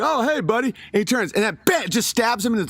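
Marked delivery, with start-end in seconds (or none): 0.0-0.7 higher, cheery mock hello; friendly greeting. Brick pig greeting his friend, higher cheery hello. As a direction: greet him brightly, pitched up. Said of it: "the pig's like".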